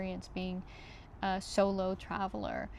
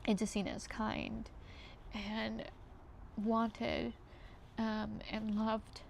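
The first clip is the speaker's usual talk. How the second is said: fighting not to cry, breathy and hushed; holding back tears; 6 dB quieter than usual. Real, not acted. Vlogger recalling being seen as good, softly near tears. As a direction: voice dropping soft and near tears. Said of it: "I'm trying not to cry".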